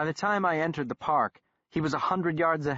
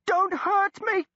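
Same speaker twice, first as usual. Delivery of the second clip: put-on much higher, louder slow squeal in an English accent; high-pitched English squeal; 4 dB louder, slower than usual. A narrator's reading. Pinned man begging the doctor, high English squeal. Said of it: "squealed in a high-pitched English accent".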